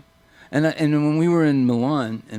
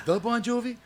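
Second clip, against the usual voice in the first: higher, astonished disbelief; stunned, pitched-up double take; amazed question; 9 semitones higher, 7 dB quieter than usual. Retold or imitated.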